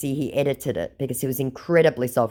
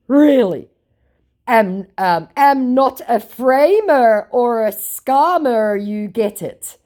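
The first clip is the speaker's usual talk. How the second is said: slow, loud, raised scammer voice; mocked scammer; 8 semitones higher, 6 dB louder, slower than usual. Scammer denying he is a scammer, mocking voice. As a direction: drag out the scammer's clumsy protest. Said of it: "say it with me".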